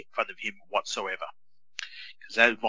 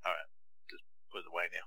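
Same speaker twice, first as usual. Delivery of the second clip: hushed whisper, much softer; 3 semitones lower, 12 dB quieter, faster than usual. Retold or imitated. Dad putting away the tweet so his son won't see, whispered. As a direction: whisper it so the kid won't hear.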